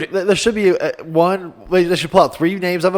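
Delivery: high and loud, bursting with an idea; excited outburst. Real, not acted.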